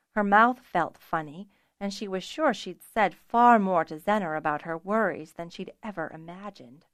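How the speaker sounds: ordinary delivery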